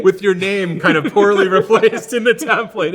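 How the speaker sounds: loud, high words through laughter; laughing mockery. Real, not acted. Joking about botched email templates, laughing loudly. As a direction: crack up while mocking it.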